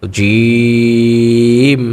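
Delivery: জিম chanted long from inside the mouth; hard long Jim. Shown as a demonstration. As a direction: give জিম a firm, drawn-out sound. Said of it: "মুখের ভিতর থেকে শক্ত আওয়াজ করে". (শক্ত আওয়াজে লম্বা জিম)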